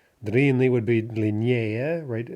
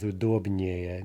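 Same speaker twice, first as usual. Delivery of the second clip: "удобнее" slow, stressed on -доб-; no stress shift; 3 semitones lower, slower than usual. Shown as a demonstration. Teacher repeating a three-syllable example, "удобнее" with stem stress. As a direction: keep the weight on the stem of "удобнее". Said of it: "no stress shift".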